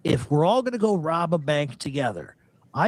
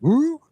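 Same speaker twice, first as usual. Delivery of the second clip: high mock-ghostly ooh; a cartoon spooky wail; hammed-up Scooby-Doo ooh; 12 semitones higher, 4 dB louder than usual. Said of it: "Scooby-Doo episode".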